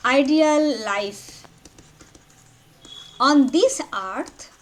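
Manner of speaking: word by word, long pauses; slow dictation. Real, not acted. Teacher giving students a sentence to write down, slow dictation. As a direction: dictate it slowly for note-takers. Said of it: "just write down".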